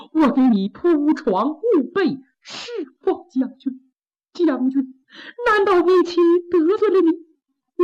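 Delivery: high, weepy plea for her life; tearful pleading wife. Acted. Wife begging her sword-wielding husband to spare her, tearful and high. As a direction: beg him to spare you, voice catching with tears.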